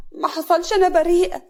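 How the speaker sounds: high, loud plea of innocence; acted tearful denial. A narrator's reading. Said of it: "هنا بدموع".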